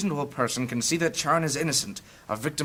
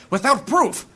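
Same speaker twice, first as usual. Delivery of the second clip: indignant outburst; 11 semitones higher, 6 dB louder than usual. Acted. Indignant priest defends accused thief.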